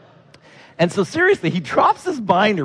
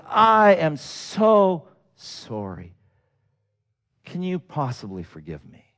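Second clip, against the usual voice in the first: very slow, fading much softer; heartfelt apology; 4 dB quieter, slower than usual. Retold or imitated. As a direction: plead for forgiveness gently. Man embraces pastor, apologizing.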